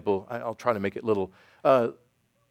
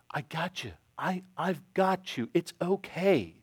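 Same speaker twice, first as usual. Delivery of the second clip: put-on soft, higher, slow reassurance to a child; reassuring; 6 semitones higher, 4 dB quieter, slower than usual. Parent to a child afraid to jump in the pool, reassuring. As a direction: gently coax a frightened little one.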